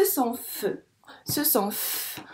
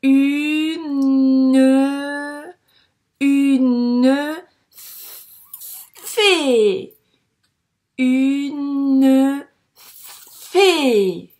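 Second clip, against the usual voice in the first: loud, stretched une fée, syllable by syllable; loud drawn-out reading; 2 semitones higher, 10 dB louder, much slower than usual. Shown as a demonstration. The teacher corrects the reading aloud, loud and drawn out. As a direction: call out each syllable slowly and loudly. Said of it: "On corrige".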